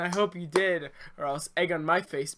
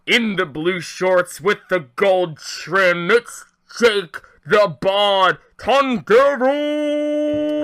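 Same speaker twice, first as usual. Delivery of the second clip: slow booming ring announcer; fighting game announcer; 5 semitones higher, 13 dB louder, much slower than usual. Pretend. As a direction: stretch it out like a boxing announcer. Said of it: "That's my best announcer voice".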